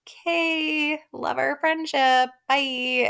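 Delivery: high, mock-sweet kiss-off; pitying brush-off. Woman leaving her admirer for another, pitying brush-off. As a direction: imitate letting him down in a high voice. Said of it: "she just really pities J T".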